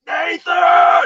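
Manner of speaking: booming yell. Retold or imitated. Father calling his son Nathan from the woods, thunderous shout. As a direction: bellow the name across the woods. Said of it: "I was yelling for my kids".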